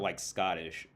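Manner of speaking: ordinary delivery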